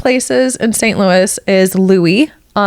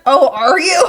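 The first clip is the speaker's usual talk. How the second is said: mock frightened; 6 semitones higher than usual. Scared babysitter voiced.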